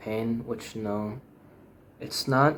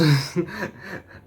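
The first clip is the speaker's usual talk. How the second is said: bitter laugh; 8 semitones higher, 4 dB louder than usual. Real, not acted. Bitter laugh at own joke.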